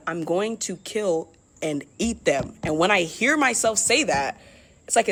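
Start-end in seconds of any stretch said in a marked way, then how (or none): 0.1-2.5 slow eerie. Retold or imitated. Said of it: "I say out loud".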